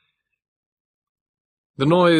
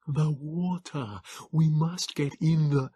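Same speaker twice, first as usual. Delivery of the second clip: wheezing Morlock; 7 semitones higher, slower than usual. A narrator's reading. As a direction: barely get the syllables out. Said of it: "His wheezing had grown so pronounced".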